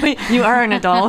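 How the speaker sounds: high, loud amused objection; laughing. Real, not acted. Friend teasing her about being an adult, laughing aloud. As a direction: laugh while correcting your friend.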